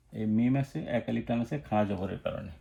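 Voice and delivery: usual speaking voice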